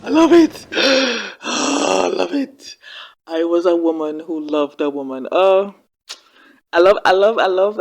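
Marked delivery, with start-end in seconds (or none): 0.0-2.8 high, loud delighted squeal and gasp; thrilled at the line, squealing high and loud; thrilled outburst. Real, not acted. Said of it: "thrilled reaction". 5.3-7.8 loud, breathless delight; gushing over the confession, loud and delighted. Real, not acted. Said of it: "delighted reaction".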